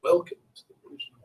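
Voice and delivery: quick, soft, clipped-off officer's welcome; official voice again. Acted. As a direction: toss it off fast like a bored official. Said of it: "it's very official".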